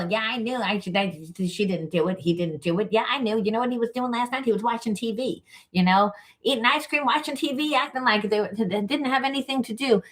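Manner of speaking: high, sneering mother mocking her child. Mother shaming her kid in front of the class, high mocking voice. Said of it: "she came up in front of the whole".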